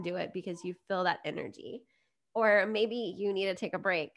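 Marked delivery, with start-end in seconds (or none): none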